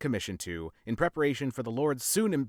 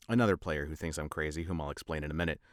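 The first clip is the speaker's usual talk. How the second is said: plain reading voice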